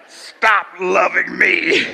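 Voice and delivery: slow, loud put-on shout; mock shout. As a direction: bark it out slowly as the woman in the film. Woman shooting cyborg, retold.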